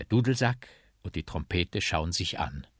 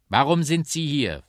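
loud, raised stern question; 8 semitones higher, 10 dB louder than usual. A narrator's reading. Policeman questions the bagpipe at the station, stern. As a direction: demand an answer like a strict officer. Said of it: "fragt der Polizist streng".

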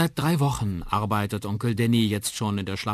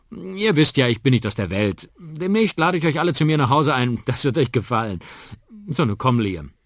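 loud, higher, upbeat farewell; cheerful; 8 semitones higher, 9 dB louder than usual. Acted. Uncle says goodbye to the family, cheerful and loud. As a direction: cheer the family up as you leave. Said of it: "wieder ganz fröhlich".

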